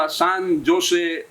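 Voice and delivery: "સાન જોસે" slowly with a hard j sound; wrong pronunciation. Retold or imitated. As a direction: stress "સાન", then "જોસે" with j as in jam. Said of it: "આવા pronounce, આવા ઉચ્ચાર કરશો".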